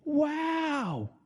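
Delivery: high, drawn-out cry of amazement; awestruck. Acted. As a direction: let out a big amazed wow. Preacher acting out sudden awe.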